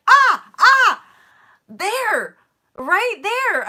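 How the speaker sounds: very high, loud pointing out; sudden aha, catching herself; excited catch. Retold or imitated. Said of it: "and I'm like".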